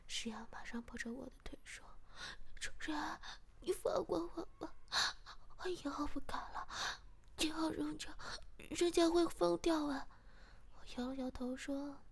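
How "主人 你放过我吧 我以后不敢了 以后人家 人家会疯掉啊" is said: high-pitched, whimpering plea; pleading. A narrator's reading. Partner begging the master for mercy, tearful pleading. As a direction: beg desperately to be let off.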